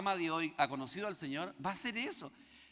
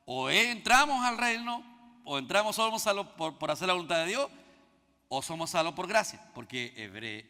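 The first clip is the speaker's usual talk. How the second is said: full-force preaching; 6 dB louder than usual. Acted. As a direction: drive the point home from the pulpit.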